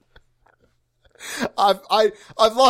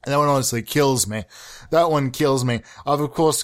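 ordinary delivery

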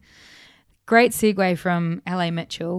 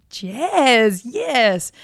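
a touch of mock glee, higher; excited jazz cry; 4 semitones higher, 4 dB louder than usual. Recalling the concert opener to the host, with light mock glee. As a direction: relish it like the crowd did. Mimics delighted concert reaction.

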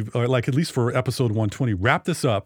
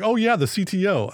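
higher, mock-knowing "Oh yeah"; imitating a smug know-it-all; snarky inner voice; 8 semitones higher than usual. Host voicing the snarky part of himself, mock knowing. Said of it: "the snarky part of me is like".

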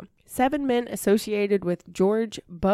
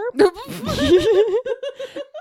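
loud rising cackle; high burst of laughter; 6 dB louder than usual. Real, not acted. Host laughing at her own massacre segue, a loud high cackle. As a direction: let out a big squealing laugh.

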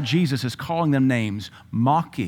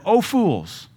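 higher, mock scolding; a raised rebuke from Jesus; Jesus rebuking; 9 semitones higher, 4 dB louder than usual. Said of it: "Then he said unto them".

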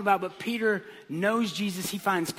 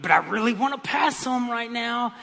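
higher, louder mock whine; whining protest; 5 semitones higher, 5 dB louder than usual. Driver arguing with the little angel, whining. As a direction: plead like a frustrated driver.

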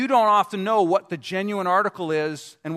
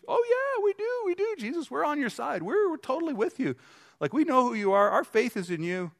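high, gushing mock voice of the disciples; mock eager disciples; 8 semitones higher than usual. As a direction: pitch it way up like overeager followers.

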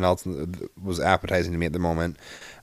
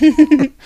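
loud laugh; 9 dB louder than usual. Real, not acted. Laughing at own story.

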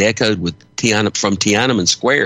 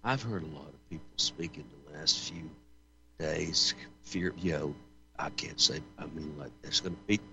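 faint, breaking-up phone line; audio cutting out; 4 semitones lower, 19 dB quieter than usual. Real, not acted. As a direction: voice dropping out on a bad connection. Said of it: "speak up a little bit there".

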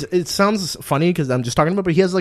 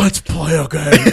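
slow, loud, menacing Jigsaw; 3 semitones higher, 7 dB louder, slower than usual. Retold or imitated. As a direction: drag it out like the Saw puppet. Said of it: "can I hear your Jigsaw again".

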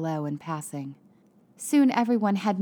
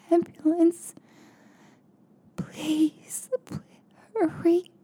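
frightened whisper; 10 semitones higher, much slower than usual. Acted. Dying woman on 911 recording.

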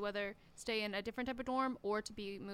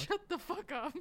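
meme voice; 5 semitones higher than usual. Retold or imitated. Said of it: "it's like that one meme".

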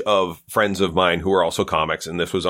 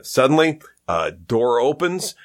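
ordinary talk, nothing marked

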